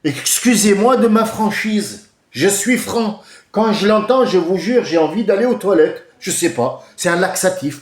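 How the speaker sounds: high, loud and emphatic; loud ranting. Real, not acted. Speaker tells listeners what the politician's voice does, ranting. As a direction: raise the voice in an outspoken rant. Angry rant against a politician.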